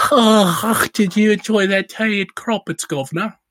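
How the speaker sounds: put-on high, hammy posh English accent; bad English accent. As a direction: overdo a terrible English accent. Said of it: "That's a terrible English accent".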